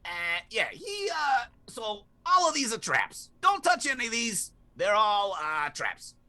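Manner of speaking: high parrot voice; squawky bird warning. Acted. Parrot explains traps.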